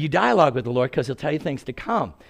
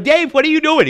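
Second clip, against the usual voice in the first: high, loud disbelieving yell; shocked shout at the roommate; alarmed shout; 10 dB louder than usual. Retold or imitated. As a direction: cry out at your friend in shock. Alarmed at roommate lifting man.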